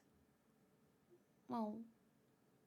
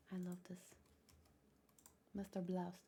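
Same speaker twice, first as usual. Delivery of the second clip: whisper; 3 semitones lower, 8 dB quieter than usual. Real, not acted. Reacting quietly to a scene.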